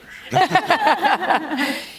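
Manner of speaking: light, high laugh; amused laugh. Real, not acted. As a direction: chuckle along with the guest. Host laughs at Disney joke.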